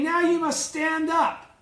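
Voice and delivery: slow, high and loud, every word weighted; firm command. Retold or imitated. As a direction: lay down the order slowly. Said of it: "He's now saying".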